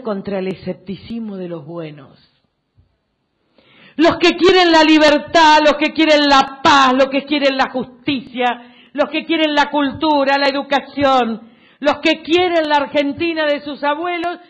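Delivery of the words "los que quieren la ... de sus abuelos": loud, driving list to a crowd; impassioned rally oratory. Real, not acted. Politician to supporters about those who want liberty, impassioned oratory. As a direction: pound out each phrase for the crowd.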